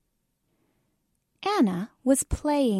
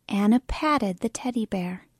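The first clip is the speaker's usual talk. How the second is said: usual speaking voice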